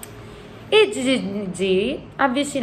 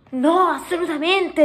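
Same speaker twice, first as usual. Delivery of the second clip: high, insistent no; emphatic refusal; 11 semitones higher than usual. A narrator's reading. Sofia forbidding the giant, emphatic refusal. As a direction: refuse firmly, pitched up.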